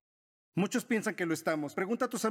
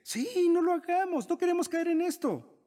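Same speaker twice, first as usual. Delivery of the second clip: higher, louder eager voice of the flesh; the flesh agreeing; 9 semitones higher, 3 dB louder than usual. Acted. Preacher voicing the flesh to the congregation, eager and loud. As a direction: cave in eagerly, pitched up and loud. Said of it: "tu carne dice".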